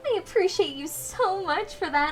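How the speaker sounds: high, bubbly service voice; sweet chirpy cashier; cute bubbly voice. Shown as a demonstration. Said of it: "all cute and bubbly".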